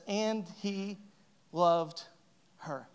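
measured, hushed dramatic reading; slow, pausing. Shown as a demonstration. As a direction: space out "And he loved her" word by word. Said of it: "and it says".

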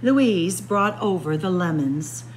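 ordinary delivery, nothing marked

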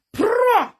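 loud, high rolled "prrro!"; explosive trumpeting fart imitation; imitated fart noise. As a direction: burst out a mock fart.

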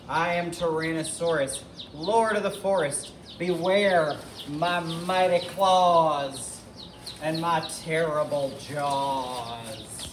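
slow, grandly stretched monster boast; roaring dinosaur. Acted. Tyrannosaurus threatens Edna, slow mock roaring boast. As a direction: proclaim it like lord of the forest, dragging the words. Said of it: "he roared".

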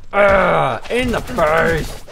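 loud, slow groan of in the face; pained yell. Real, not acted. Gamer hit by an enemy, loud pained groan.

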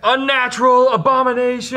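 high, loud shouts of condemnation; screaming orc mob. Acted. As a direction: yell accusations across the cells. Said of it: "all the orcs are screaming stuff".

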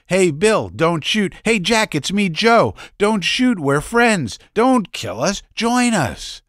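high, loud pleading calls to soldiers; mock yelling in the crowd; retold yelling. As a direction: call out to the soldiers not to shoot. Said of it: "yelling to the soldiers".

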